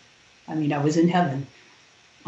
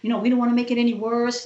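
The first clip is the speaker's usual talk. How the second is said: higher, anxious nurses saying no; retold refusal; 7 semitones higher than usual. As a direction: mimic the nervous nurses backing off. Nurses refusing to touch her.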